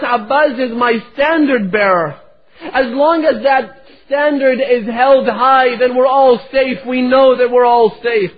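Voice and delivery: high, loud wailing sermon voice; rising lament of a preacher at full force; impassioned preaching. Acted. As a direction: plead and cry out to the congregation. Preaching the daughter's farewell.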